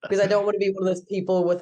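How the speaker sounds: normal talking voice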